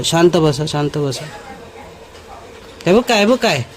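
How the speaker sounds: ordinary talk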